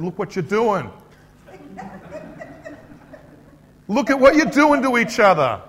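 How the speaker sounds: loud, high-pitched scolding; confronting peacemaker. Acted. Peacemaker telling off two people arguing, loud confrontation. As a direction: raise your voice and take them to task. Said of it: "A peacemaker grabs them, smacks them".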